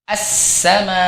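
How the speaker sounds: As-sama with a smooth, running s; flowing sin. Shown as a demonstration. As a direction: let the s in As-sama stream. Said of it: "harus dialirkan suaranya".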